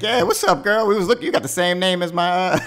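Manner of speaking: high, loud acted-out greeting; Rufus. Rufus approaches Charlotte Rose, high mock-friendly greeting. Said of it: "Rufus came over. He was like".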